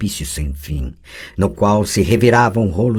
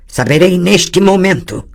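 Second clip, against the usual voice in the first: high, loud, firm retort; defiant demand; 11 semitones higher, 6 dB louder than usual. Acted. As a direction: insist on the answer right now.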